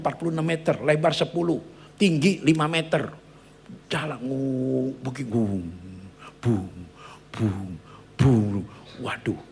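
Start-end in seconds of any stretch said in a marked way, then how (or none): 3.9-8.7 slow droning hum, then booming thuds; drawn-out vocal engine drone and booms; imitated boat noises. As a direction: mimic a boat slamming over waves. Imitates boat pitching through waves.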